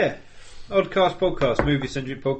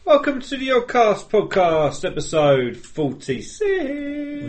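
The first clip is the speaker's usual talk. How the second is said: higher announcer welcome; show announcer; 10 semitones higher, slower than usual. Acted. Host opening the episode, higher announcer read.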